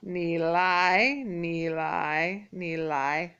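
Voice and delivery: 你来 with the third tone leading into the second; slow drilled 你来 with linked tones; tone sandhi demo. Shown as a demonstration. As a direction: glide ni into lai, third tone rising into second. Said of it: "sounds like this".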